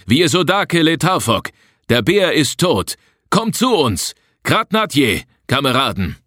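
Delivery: high-pitched hailing call to comrades. A narrator's reading. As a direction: summon the others from far away, voice pitched high. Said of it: "Wir eilten dem Rufenden zu". (hoch gerufen, Kameraden herbeirufend)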